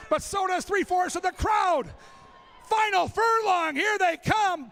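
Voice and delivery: excited shouted race finish; horse race call. Acted. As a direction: build it like the final furlong. Horse-race style final stretch call.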